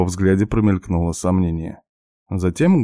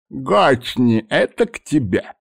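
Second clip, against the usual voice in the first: put-on high, toothless old man mumble; mumbling old man; 6 semitones higher, slower than usual. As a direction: mumble it through bare gums, high. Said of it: "прошамкал старик".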